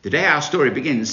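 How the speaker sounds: usual speaking voice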